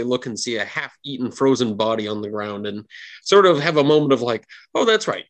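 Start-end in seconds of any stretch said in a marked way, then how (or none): 4.7-5.2 high, startled "oh" of remembering; sudden realisation. Acted. Party suddenly remembers the frozen body: startled. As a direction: snap back to the grim scene.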